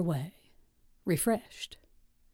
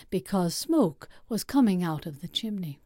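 ordinary delivery, nothing marked

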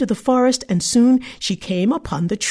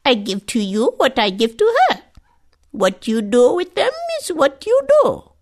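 lightly higher, otherworldly crone; cryptic old woman; 5 semitones higher than usual. Acted. The old woman answering the greedy girl, cryptic.

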